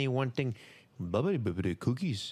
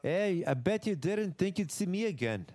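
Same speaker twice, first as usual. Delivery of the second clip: put-on accent, pitched higher; Finn Balor accent; 5 semitones higher than usual. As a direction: do a wrestler's accent in a raised voice. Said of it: "he fucking does the accent".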